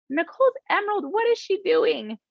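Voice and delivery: high, mocking voice of a doubter asking what she does; skeptical doubter. Imagined doubter questions her rank.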